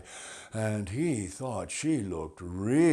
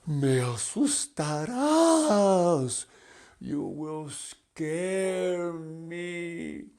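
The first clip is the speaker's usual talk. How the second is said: high, slow frightened plea; slow mock fright; 7 semitones higher, 4 dB louder, slower than usual. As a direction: draw it out, trembling butterfly. Butterfly scared by bark.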